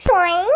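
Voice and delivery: long nasal glide that swoops upward; playful "soing" whoosh. Acted. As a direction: stretch the sound into a rising slide. Imitating a whooshing ride, playful. (விளையாட்டாக இழுக்கும் சறுக்கு ஒலி)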